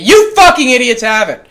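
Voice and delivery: scornful loud jab; snapping insult. Acted. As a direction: snap it at the audience. Sudden insult after quiet talk.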